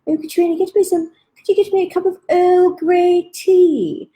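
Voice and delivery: grandma's fancy put-on voice; grandmother's put-on voice. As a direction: lift the voice way up like a grand old lady. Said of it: "she'd put her voice on".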